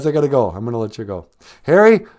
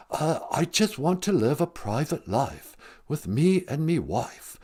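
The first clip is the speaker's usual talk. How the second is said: Harry; 5 semitones lower, 8 dB quieter than usual. Acted.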